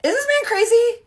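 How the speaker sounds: high, loud cry of disbelief; incredulous outburst. Retold or imitated. Storyteller to viewers about her ex's demand, incredulous shout. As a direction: yell it out, scandalized by the nerve.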